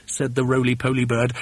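plain reading voice

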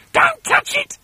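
very high warning shriek; piercing high-pitched alarm; Missus Twit crying out. A narrator's reading. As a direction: screech it as an urgent warning. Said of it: "cried Missus Twit".

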